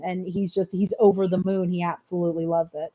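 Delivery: usual speaking voice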